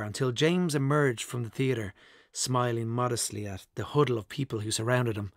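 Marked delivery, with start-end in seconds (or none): none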